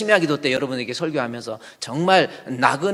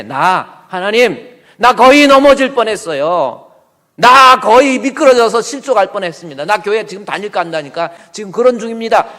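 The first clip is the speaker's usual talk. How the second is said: anguished cry to God; 3 semitones higher, 9 dB louder than usual. Acted. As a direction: cry out that you nearly fell away. Asaph crying out to God.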